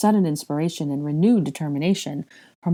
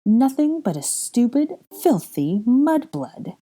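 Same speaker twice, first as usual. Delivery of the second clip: higher, slow, stretched-out insult; venomous villain's disdain; contemptuous; 7 semitones higher, slower than usual. Acted. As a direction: savour each scornful word. Villain insults her captive.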